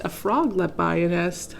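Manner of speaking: plain reading voice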